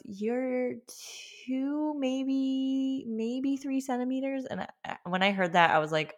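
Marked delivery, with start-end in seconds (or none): 0.0-4.4 high, drawn-out unsure estimate; imitating someone unsure, pitched up and dragging; hesitant trainee nurse. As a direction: stretch each word while guessing.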